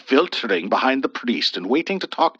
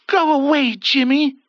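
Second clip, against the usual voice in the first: dismissive; 13 semitones higher, 5 dB louder than usual. A narrator's reading. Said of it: "Ethel said".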